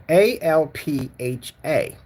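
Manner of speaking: slow, letter by letter "A L P H A"; slow careful spelling of "A L P H A". Shown as a demonstration. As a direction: spell each letter of "A L P H A" separately. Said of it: "This is how we spell alpha".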